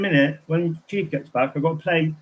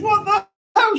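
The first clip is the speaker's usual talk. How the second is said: very high, louder squeal of shock; imitating the crystal shop lady's amazed shriek; 5 dB louder than usual. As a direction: squeak it out like she's seen a ghost. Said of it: "she goes".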